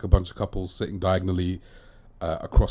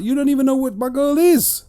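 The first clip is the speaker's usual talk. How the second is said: high, loud mock outrage; over-the-top offended retort; indignant comeback; 6 dB louder than usual. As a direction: fire it back, pitched way up. Imagined comeback to critics.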